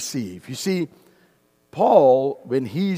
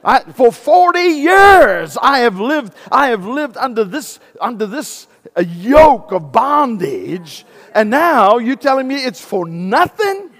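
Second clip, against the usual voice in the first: put-on high, loud, indignant elderly lady; hammed-up outraged churchgoer; 8 semitones higher, 11 dB louder than usual. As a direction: give the pastors a piece of her mind. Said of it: "She says".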